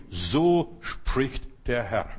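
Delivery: low, very slow, weighty pronouncement; deep solemn slow oracle voice; solemn proclamation. Acted. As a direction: intone it like a prophet speaking for God. Preacher quoting God's word.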